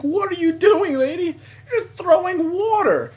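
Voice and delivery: re-enacted high, loud holler; incredulous yelling. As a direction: shout up at her in mock outrage. Acts out yelling at the lady.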